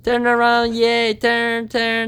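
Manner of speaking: high, sing-song repeated commands; high coaxing to Diego; pet training talk. Real, not acted. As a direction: coax the bird round and round. Training the parrot to turn.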